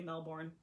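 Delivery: Melbourne as MEL-born; pronounced as spelled. Shown as a demonstration. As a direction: sound out the full "born" in Melbourne. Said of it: "if you wanna say Melbourne".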